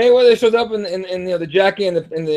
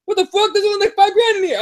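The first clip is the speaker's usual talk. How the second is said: very high incredulous squeal of a taunt; mock outraged astonishment; mocking disbelief; 11 semitones higher than usual. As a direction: imitate a fighter jeering, pitched way up. Same taunt acted out again.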